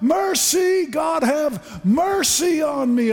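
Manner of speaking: high shouted plea for mercy; desperate shouted prayer; crying out. Retold or imitated. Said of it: "you can just cry out".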